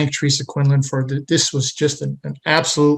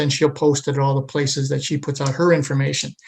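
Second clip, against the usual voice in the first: usual speaking voice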